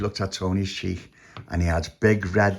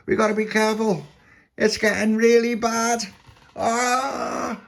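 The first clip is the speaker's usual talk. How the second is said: high, drawn-out warning ending in a cry; alarmed puppy; 13 semitones higher than usual. A narrator's reading. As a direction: warn the crew in a high puppy voice. Puppy warns of the storm.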